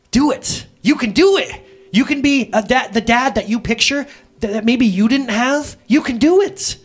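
loud, high pep talk; rousing encouragement. Retold or imitated. God urging a doubting dad, loud and high. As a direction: pump him up like a coach. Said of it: "God is encouraging me".

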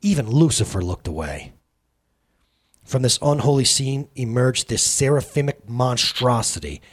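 plain reading voice

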